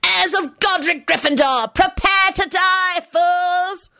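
high, slow grandiose threat; villain scream. A narrator's reading. Scully threatens the Gryffindor heirs, screaming. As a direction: proclaim doom in a high scream.

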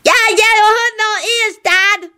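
put-on very high, boyish yell. A narrator's reading. Boy to dad about awful singing, yelling. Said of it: "Nicholas yelled".

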